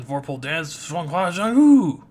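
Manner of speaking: loud, high, slow battle cry; drawn-out loud attack name; attack call. Acted. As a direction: call out the special move with full force.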